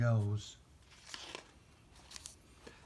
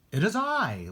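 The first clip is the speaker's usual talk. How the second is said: mock-grand, pitched up; grand boyish announcement; 8 semitones higher, 4 dB louder than usual. A narrator's reading. Boy answering his mother with mock grandeur. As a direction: say it like a knight, half joking. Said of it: "sounded both right and funny".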